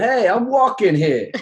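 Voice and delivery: loud, high, slow mock New York shout; brash, hammed-up New York accent; exaggerated New York accent. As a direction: holler it like a cartoon New Yorker. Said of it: "this exaggerated New York kind of accent".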